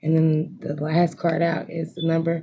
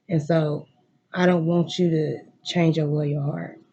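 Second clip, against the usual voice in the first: ordinary delivery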